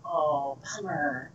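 low, soft disappointment; quiet, dropped-voice reaction to bad news; retold letdown. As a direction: let it sink to a deflated near-murmur. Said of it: "And I went".

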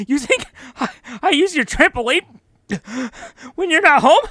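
rising, nervous mock guilt; a sweating neighbor losing his nerve. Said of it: "and he starts sweating".

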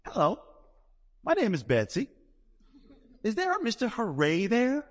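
put-on high, sing-song Georgia accent; Southern lady voice. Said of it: "She goes".

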